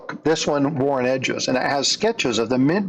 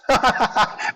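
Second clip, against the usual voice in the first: a short bright giggle, pitched higher; child's laugh; 9 semitones higher than usual. A narrator's reading. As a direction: giggle like a delighted kid. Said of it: "Leo laughs".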